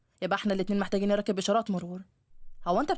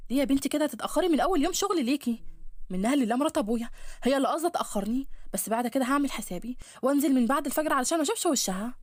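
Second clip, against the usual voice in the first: put-on higher, lively girlish voice; young woman's voice; 6 semitones higher than usual. Laila complaining to Fatoun about her stepmother, higher voice. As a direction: voice the girls chatting outside, bright and higher.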